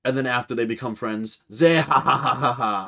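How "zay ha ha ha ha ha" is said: big hammed-up zehahaha cackle; belly-laugh like a rowdy pirate. Blackbeard laughing with his new drinking buddy, hammed-up cackle.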